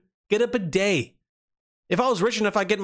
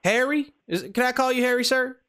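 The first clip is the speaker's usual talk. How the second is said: high-pitched Southern prank caller; Southern accent; 9 semitones higher than usual. Pretend. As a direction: put on a friendly Southern voice, pitched up. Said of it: "we're doing the Southern accent".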